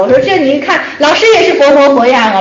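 normal talking voice

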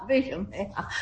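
soft amused laugh into the question; quietly amused laugh. Real, not acted. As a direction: chuckle as you ask why. Teacher laughs at the answer.

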